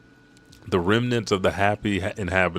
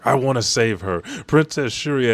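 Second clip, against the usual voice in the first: raised, louder mock plea; retold tearful plea; 5 semitones higher, 4 dB louder than usual. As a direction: plead as if through tears, lightly acted. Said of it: "as he cries to the rest".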